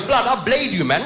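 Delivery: high, swaggering street slang; mock London roadman; roadman kid. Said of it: "He goes".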